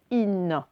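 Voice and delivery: in said slowly with a clear final n; sounded-out in. Shown as a demonstration. Teacher modelling in for pupils, drawn out. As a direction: draw out in, pronouncing the n. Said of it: "si je mets un E".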